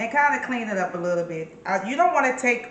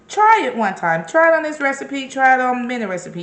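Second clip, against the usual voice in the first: higher, faster push to try it; eager urging; 5 semitones higher, 5 dB louder than usual. Real, not acted. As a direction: sell it to the viewers, excited. Urging viewers to try seasoning.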